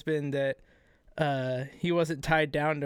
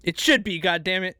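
indignant insistence; 4 semitones higher, 6 dB louder than usual. Real, not acted. Insisting after being contradicted.

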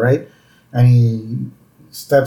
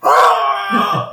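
higher mock scream of alarm; panicked scream; 7 semitones higher than usual. Worker reacting to boss's sudden insult, mock panicked scream. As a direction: cry out in hammed-up panic.

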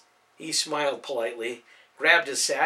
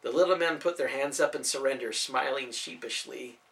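ordinary delivery

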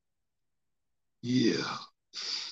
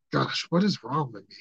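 ordinary talk

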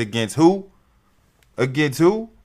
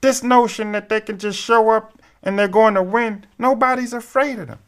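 lightly voiced Barkley, higher; a hint of Barkley scoffing; Charles Barkley voice; 10 semitones higher than usual. Host finishing Barkley's quote, light Barkley impression. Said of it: "the Charles Barkley voice reference".

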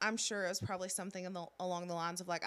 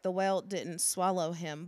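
ordinary delivery

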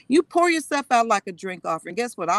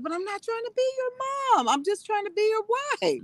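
high-pitched pleading; 14 semitones higher, 3 dB quieter than usual. Retold or imitated. Wife insisting to her husband she isn't his mom, high-pitched pleading. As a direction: imitate a wife's plea in a high, stretched voice. Wife's protest acted to husband.

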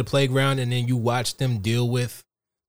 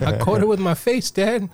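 lightly voiced, higher-pitched kid; mock child; 9 semitones higher than usual. Kid explaining a caught punch to his dad, mock childish. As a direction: play a kid proudly reporting to Dad.

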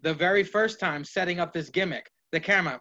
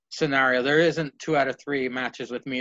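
ordinary talk, nothing marked

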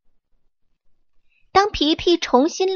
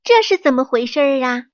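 anxious question; 3 semitones higher, faster than usual. Acted. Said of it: "皮皮焦急地问".

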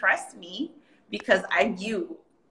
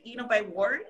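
ordinary talk

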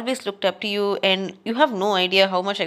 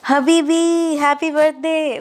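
high, loud and beaming; slow, high, loud birthday cheer; excited greeting; 8 semitones higher, 5 dB louder, slower than usual. Real, not acted. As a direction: throw it out like a joyful cheer.

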